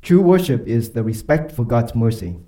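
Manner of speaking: ordinary talk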